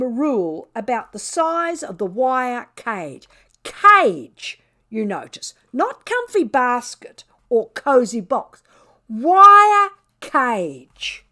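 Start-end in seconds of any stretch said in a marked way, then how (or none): 3.6-4.6 loud, high, offended cry of cage; high indignant yelp; indignant outburst. A narrator's reading. Indignant cat narrator. 5.7-11.3 growing indignant outrage; outraged. A narrator's reading. Indignant cat narrator.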